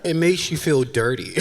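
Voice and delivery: ordinary talk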